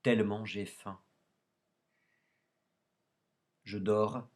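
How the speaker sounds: measured, solemn poem recitation; poetry reading. Acted. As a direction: recite line by line, pausing between phrases. Lit un poème de René Char. (récitation de poème, posée et solennelle)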